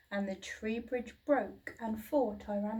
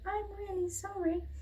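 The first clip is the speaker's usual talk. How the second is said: much higher small apologetic voice; timid little dinosaur; 10 semitones higher than usual. A narrator's reading. Little dinosaur apologising to his parents, high and small. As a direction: squeak it out like a shy hatchling.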